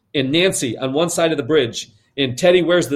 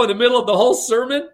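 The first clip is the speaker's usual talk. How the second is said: plain reading voice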